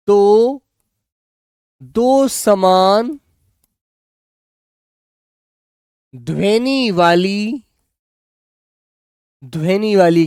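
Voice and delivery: each word drawn out for students to write; spaced-out dictation pace; slow dictation. Shown as a demonstration. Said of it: "लिखेंगे जिस क्रिया में".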